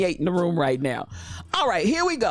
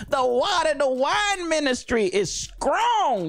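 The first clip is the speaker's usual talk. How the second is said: slow mock proclamation; 8 semitones higher, slower than usual. Real, not acted. Joking, mock sermon.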